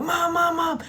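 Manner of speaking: high, loud, frantic cry; acted panic, pleading down the phone; panicked calling. Girl fleeing through woods phones mom, panicked calling.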